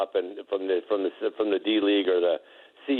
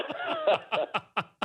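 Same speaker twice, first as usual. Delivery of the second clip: real high squealing laugh; 4 dB quieter than usual. Real, not acted. Recalling Larry mocking Mason's muscles, real high laughter. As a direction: burst out laughing. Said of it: "I just kinda laughed about it".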